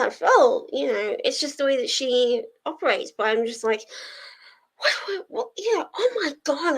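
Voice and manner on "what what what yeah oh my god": high, flustered acted stammer; mock panic. Reacting to a friend's bad news, mock panic. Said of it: "immediately panic".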